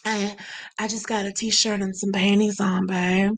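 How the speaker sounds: low, soft pretend sexy voice; hammed-up phone seduction, soft and low; mock seductive murmur. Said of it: "And you be like".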